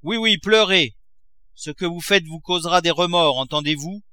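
a father scolding his crying daughter; angry shout. A narrator's reading. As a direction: berate his weeping daughter. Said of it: "cria-t-il".

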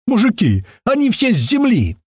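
high, excited shout to the guys; excited yell. A narrator's reading. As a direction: burst in yelling the news, voice pitched high. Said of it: "и с порога заорал".

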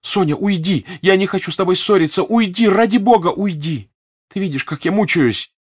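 high, loud, exasperated cries; anguished shouting, urgent and high; angry, desperate. A narrator's reading. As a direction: drive the friend away in irritated despair. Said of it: "злобно кричала Наташа сдержанно раздражённым и отчаянным голосом".

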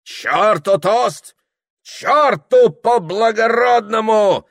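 high, loud, slow shouting; rowdy dismissal. A narrator's reading. Host rejecting the officer's toast, shouting wildly. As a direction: shout it down, stretching each word. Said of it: "махал руками, тряс кудлатой бородой хозяин".

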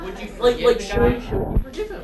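emphatic question. Real, not acted. Teacher challenging students, loud and emphatic. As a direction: push the class to answer. Teacher poses the dilemma loudly.

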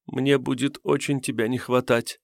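tearful farewell, hushed; crying. Acted. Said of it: "плакала клериканка".

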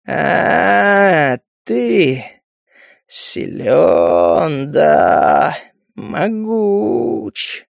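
slow, drawn-out shaman voice; lazy old shaman. A narrator's reading. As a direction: speak like a bored, heavy elder. Lazy high shaman speaks.

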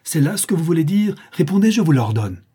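high, loud, angry insistence; furious command. A narrator's reading. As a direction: order them in a fury. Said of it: "cria la tante en fureur".

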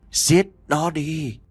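slow, cold ghost voice; cold ghostly whisper. Acted. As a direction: urge them coldly and slowly. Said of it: "lạnh lùng vang lên".

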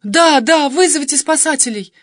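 high, loud, urgent cry; desperate shout. A narrator's reading. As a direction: shout eagerly for help. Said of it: "закричала я".

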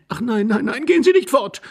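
very high, sudden anxious cry; frightened young wife. A narrator's reading. Wife begs husband not to leave, fearfully. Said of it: "rief die junge Frau plötzlich angstvoll".

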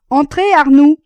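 high, loud call through a door. A narrator's reading. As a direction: call out sharply to whoever knocked. Answering a knock at door. (appel lancé d'une voix forte)

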